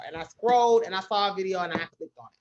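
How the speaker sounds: ordinary talk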